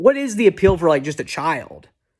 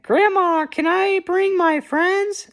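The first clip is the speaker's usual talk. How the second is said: put-on very high, slow pleading kid voice; child asking grandma, high and drawn out; Charlie Brown; 3 dB louder, slower than usual. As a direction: squeak it up like a small hopeful boy. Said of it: "he's like".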